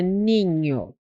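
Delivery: NINHO drawn out, NH like Spanish "ñ"; NH como ñ espanhol. Shown as a demonstration. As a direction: hold the "ñ" glide in NINHO. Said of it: "em espanhol". (NINHO alongado, com som de "ñ" espanhol)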